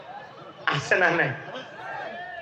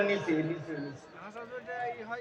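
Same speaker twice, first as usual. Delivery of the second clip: fading to near silence; 2 semitones lower, 15 dB quieter, much slower than usual. Acted. Trailing emphatic repetition.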